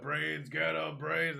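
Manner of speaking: loud, high zombie yelling imitation; mock zombie scream. Zombies turning on the kid, hammed-up screaming. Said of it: "They were screaming out".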